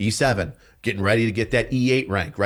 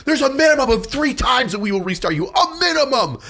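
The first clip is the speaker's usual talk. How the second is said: raging berate; 13 semitones higher than usual. Retold or imitated. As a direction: scream down at the trainees. E7 berates trainees at rage level.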